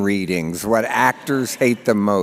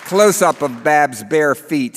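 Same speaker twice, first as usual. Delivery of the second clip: ordinary delivery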